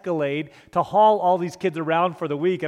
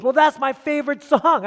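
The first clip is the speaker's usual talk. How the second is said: put-on higher, louder little-boy protest; whiny kid; 8 semitones higher, 6 dB louder than usual. Boy in the back seat tells the youth pastor, mock whine. Boy defends his favorite song.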